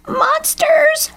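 very high storybook shriek of alarm. A narrator's reading. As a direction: shriek it like a panicked cartoon crow. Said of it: "shrieked J-, Lucy Crow".

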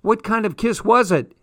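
loud, high curious call; yelling down. A narrator's reading. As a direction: yell the question downstairs. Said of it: "I heard Tom yelling down".

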